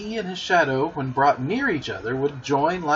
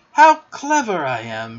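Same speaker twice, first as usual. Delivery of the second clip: a louder, delighted boast; crowing with glee; 7 dB louder than usual. A narrator's reading. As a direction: crow it out, thrilled with himself. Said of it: "he crowed rapturously".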